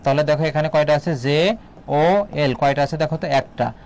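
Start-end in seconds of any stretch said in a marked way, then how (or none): 1.2-2.5 slow letter-by-letter spelling; teacher spelling a word letter by letter, slowly. Shown as a demonstration. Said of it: "যদি লিখি জল".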